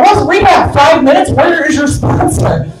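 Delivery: high, disbelieving questions; mock incredulous. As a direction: act shocked he skips rehab. Incredulous reply about rehab.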